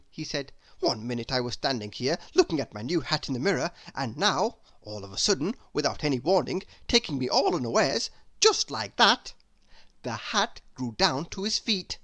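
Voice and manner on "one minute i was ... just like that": fast, breathless rambling; chattering Mr Chatterbox. Acted. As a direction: gabble on without stopping.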